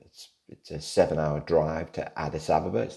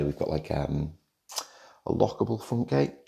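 normal talking voice

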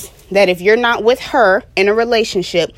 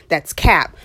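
ordinary talk

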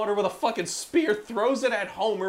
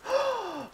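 sharp high gasp of fake shock; mock horrified gasp. Reacting to the cursed frogurt with a mock gasp. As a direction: gasp like hearing terrible news.

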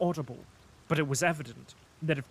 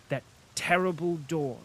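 usual speaking voice

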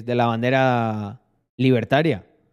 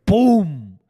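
crash sound effect; 4 semitones higher, 4 dB louder than usual. Retold or imitated.

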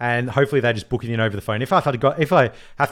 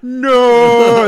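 high, loud mock cry; hammed-up despairing no; staff scream no; 11 semitones higher, 11 dB louder than usual. Twitter staff wailing as they vanish, loud mock cry. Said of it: "Twitter staff are like".

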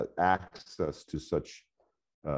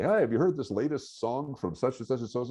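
higher, bright-eyed mock enthusiasm about a new song; eager question; 9 semitones higher than usual. Professor replaying his excited question to students, higher and keen. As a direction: pitch it up like you're sharing hot news. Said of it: "I frequently embarrass myself when I say".

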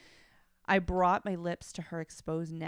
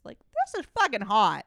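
excited; 4 semitones higher, 11 dB louder than usual. Real, not acted. Excited reaction to story.

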